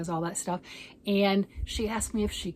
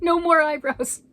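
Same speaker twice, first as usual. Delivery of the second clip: imitating a husband's loud, high outburst; husband's order; 8 semitones higher, 9 dB louder than usual. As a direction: blurt it out much higher and louder, mock-stern. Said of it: "He said".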